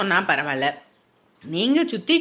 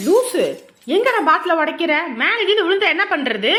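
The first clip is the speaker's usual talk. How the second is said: higher, louder telling-off; alarmed scolding; 5 semitones higher, 3 dB louder than usual. Acted. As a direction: scold a reckless friend in alarm. Friend smashes a bottle nearby.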